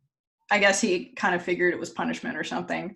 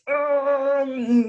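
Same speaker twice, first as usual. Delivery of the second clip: long, loud, higher groan of effort; straining groan; 7 semitones higher, 5 dB louder than usual. Retold or imitated. Storyteller as Fenrir breaking chains, straining groan. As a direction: imitate heaving against heavy chains.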